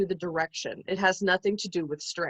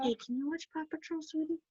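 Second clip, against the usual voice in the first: much softer and quick; soft quick coaxing; 6 semitones higher, 11 dB quieter, faster than usual. Real, not acted. Sending toddler off.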